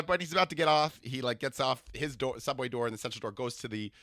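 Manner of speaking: ordinary talk, nothing marked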